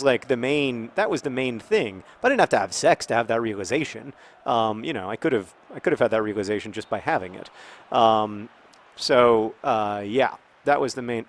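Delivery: normal talking voice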